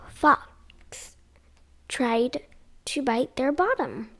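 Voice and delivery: lively sing-song read-aloud; storybook reading. Acted. Mum reading a picture book to kids, lively. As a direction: read it like bedtime story time.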